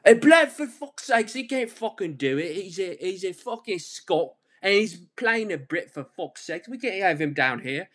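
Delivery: mock outraged Brit. Podcaster mocks critics of the Scottish casting, hammed-up outrage. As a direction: ham up an indignant British critic. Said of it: "Is like".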